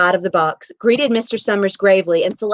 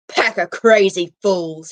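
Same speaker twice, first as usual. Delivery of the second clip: slow, scornful grumble; snorting scorn; 4 dB louder, slower than usual. Acted. Old man scoffing at the young folks, slow scorn. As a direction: spit out the contempt, slowly. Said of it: "Old Man Warner snorted".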